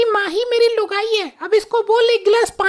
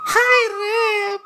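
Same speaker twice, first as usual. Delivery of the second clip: long drawn-out lamenting cry; hammed-up wifely lament; theatrical wail; much slower than usual. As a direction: stretch it into a slow, tearful wail. Wife laments missing husband.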